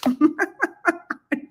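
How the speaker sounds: a short, high real laugh; high burst of laughter. Real, not acted. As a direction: laugh it off, amused. Laughing about the private jet.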